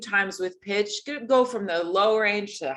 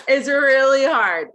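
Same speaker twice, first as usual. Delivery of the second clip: said through laughter, louder; laughing while talking, louder; 4 semitones higher, 8 dB louder than usual. Real, not acted. Laughing after student's attempt.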